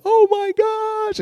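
very high, drawn-out mock squeal of delight; imitating thrilled kid. Dad imitating his kid offered twenty dollars, thrilled high squeal.

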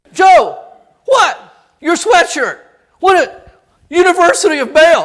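loud, high, mock-alarmed whisper-shout; comic friend voice. Frank warns Joe about his cap, loud mock alarm. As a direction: point out the cap in mock panic. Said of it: "Frank looks at Joe and goes".

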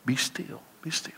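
hushed, soft whispered "Be still". Shown as a demonstration. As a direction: whisper it like God's gentle voice.

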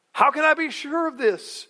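doubting, loud high question; scoffing old priest demanding proof; skeptical. Retold or imitated. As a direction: challenge the angel's promise. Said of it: "He's speaking with skepticism".

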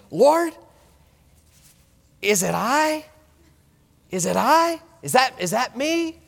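high, earnest asking of the Lord; pleading prayer. Pretend. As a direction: search your heart aloud, pleading. Praying self-examination.

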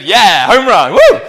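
loud, high whoop of self-congratulation; excited cheer. Real, not acted. Preacher cheering himself on before the congregation, a loud excited whoop. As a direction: celebrate the word like a home run. Cheering his own Spanish accent.

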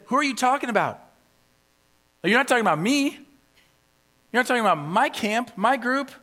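high, loud mock indignation; indignant disbelief. Congregation protesting to Paul that he means others, indignant disbelief. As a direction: act affronted, passing the blame along. Imagined readers hearing Paul's rebuke, indignant.

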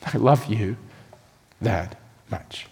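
soft, low, laughing love; softly spoken with a little laugh; tender reassurance. Real, not acted. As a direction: say it like a gentle secret. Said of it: "I'm saying to you".

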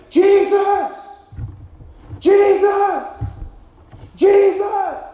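high, loud desperate shout. Acted. Preacher voicing lepers crying to Jesus, loud desperate shout. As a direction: cry out to be heard far away. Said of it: "so they shout out".

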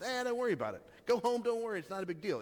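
casual brush-off. Retold or imitated. Pastor mimicking the wrong sense of Jesus's words, breezily dismissive. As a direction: wave it away as nothing. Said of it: "It's not just".

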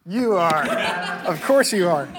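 single out the accountant with a grin; playful teasing call-out. Real, not acted. Preacher teases the accountant in the congregation, loud and playful.

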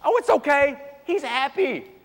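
high, breezy excuse-making; indulgent dad. Retold or imitated. Father excusing his son to a friend in the stands, in a high doting voice. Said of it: "and I said this".

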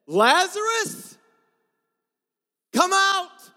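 slow, loud commanding call. Acted. Pastor voicing Jesus calling Lazarus from the tomb, loud. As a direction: call the dead man out of the tomb. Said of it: "with loud voice".

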